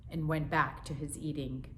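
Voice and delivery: plain reading voice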